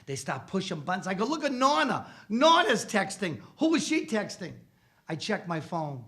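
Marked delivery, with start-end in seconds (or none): 1.2-4.5 high, loud mock amazement at Nonna; mock excited. Teasing the family about Nonna, high and loud. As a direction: point and gasp at Nonna texting.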